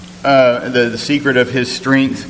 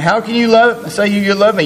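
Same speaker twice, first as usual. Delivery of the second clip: much higher, whining nag, hammed-up; mock whiny nagging wife; 8 semitones higher, faster than usual. Preacher mimics Delilah nagging Samson, high mock whine. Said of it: "you can just hear this conversation".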